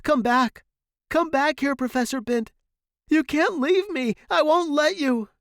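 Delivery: high, loud frantic cry; desperate shout. A narrator's reading. Student to dying professor, desperate shouting. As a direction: shout him back to life.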